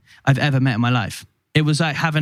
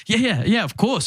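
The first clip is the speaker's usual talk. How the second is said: eager; 7 semitones higher than usual. Retold or imitated. Eager reply to the Pope.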